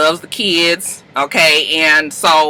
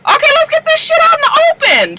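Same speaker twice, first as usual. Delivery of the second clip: very high-pitched, indignant cry; exasperated demand. Acted. Vlogger demanding the lies be aired, high exasperated cry.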